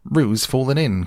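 high-pitched, urgent call; alarmed cry. A narrator's reading. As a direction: raise the alarm, pitch jumping up. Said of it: "cried Rabbit".

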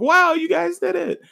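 very high-pitched mock cheering; mock praise. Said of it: "while everybody's praising them".

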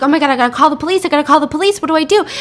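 retold panic, racing and rising; freaking out. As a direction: act out a frantic fluster, words tumbling out. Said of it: "she starts freaking out".

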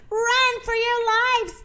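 high, slow cry of alarm; mild mock shout. A narrator's reading. Zeke warning the animals to flee, mock shouting. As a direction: call it high, held back in volume. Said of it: "shouted Zeke".

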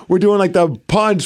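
usual speaking voice

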